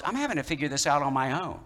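ordinary delivery

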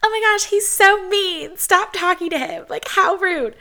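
high, loud mock outrage; mock outraged viewer. Viewers scolding her about her boyfriend, high mock outrage. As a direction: play the shocked audience scolding her. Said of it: "so many of you are like".

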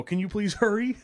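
panicked plea. Retold or imitated. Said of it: "I'm panicking too".

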